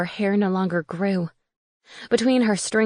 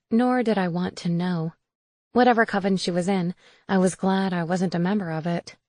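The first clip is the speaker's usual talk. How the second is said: plain reading voice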